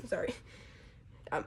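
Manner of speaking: normal talking voice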